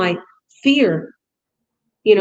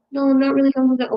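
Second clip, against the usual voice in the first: hesitant student reply; 5 semitones higher, much faster than usual. Retold or imitated.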